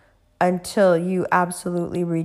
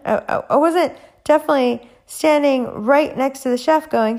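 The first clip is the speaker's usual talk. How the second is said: normal talking voice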